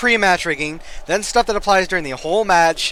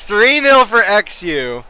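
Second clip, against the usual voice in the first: louder, slow score call; announcer call; 5 semitones higher, 7 dB louder, slower than usual. Acted. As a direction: boom out the score like a commentator.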